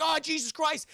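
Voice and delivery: high-pitched mock panic; hammed-up startled exclamation; alarmed cry. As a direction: yelp it as if suddenly under fire. Shot at, reacting in alarm.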